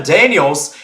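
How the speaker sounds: ordinary talk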